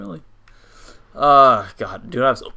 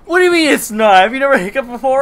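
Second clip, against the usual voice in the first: indignant; 10 semitones higher, 8 dB louder, faster than usual. Real, not acted. Indignant about hiccups.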